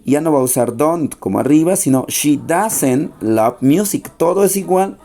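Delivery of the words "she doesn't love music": slow model sentence. Shown as a demonstration. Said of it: "miren cómo ha cambiado".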